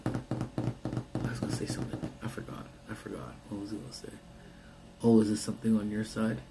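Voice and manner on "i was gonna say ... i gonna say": soft muttering to himself. Real, not acted. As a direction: mumble it under your breath. Forgot, muttering to self.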